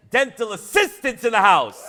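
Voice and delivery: high, loud roll call; rally the room for a cheer; crowd call-out. Real, not acted. Rallying audience from the stage.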